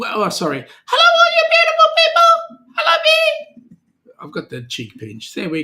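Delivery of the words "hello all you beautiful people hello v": squeaky greeting voice. Pretend. Greeting viewers in a put-on voice.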